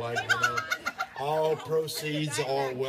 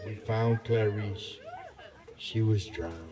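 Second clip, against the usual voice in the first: mock grave; 6 semitones lower, slower than usual. Grave news in joke.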